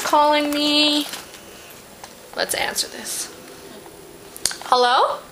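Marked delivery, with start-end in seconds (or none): 2.4-3.3 unvoiced whisper aside. Real, not acted. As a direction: whisper it before the call. Answering a phone call.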